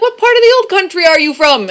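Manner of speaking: high, loud mock old-man questioning; imitated old guy. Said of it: "this old guy legitimately thought we were from England".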